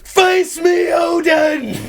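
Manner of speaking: high, drawn-out theatrical yell; yelling at the heavens. Shown as a demonstration. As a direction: bellow a challenge up at the sky. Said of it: "just yell towards the heavens".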